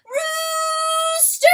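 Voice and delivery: very high, loud shout of joy; triumphant cry. Acted. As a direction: pierce the roaring wind with a triumphant shout. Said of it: "his own triumphant cry".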